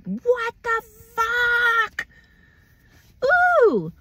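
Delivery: very high, drawn-out mock wail; exasperated cry. Acting out someone blamed for everything, exasperated. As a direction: stretch it into a high-pitched exasperated howl.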